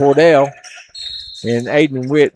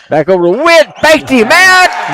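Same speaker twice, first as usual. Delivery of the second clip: excited call; 12 semitones higher, 7 dB louder than usual. Real, not acted. Play-by-play of a big fake.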